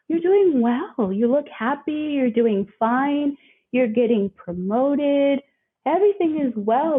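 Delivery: high, slow, bright-faced mock praise; sing-song imitation of people saying she's fine; lightly acted cheerful onlookers; voicing others' praise.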